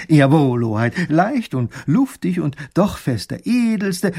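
loud, formal captain bowing to his guest; captain courting the little grand duke; ceremonious sea captain. Acted. Captain welcoming the grand duke aboard, ceremonious.

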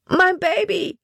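very high, emotional cry. A narrator's reading. Emma receives her baby in her arms, crying out. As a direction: cry out high, overcome at the sight. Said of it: "schrie sie".

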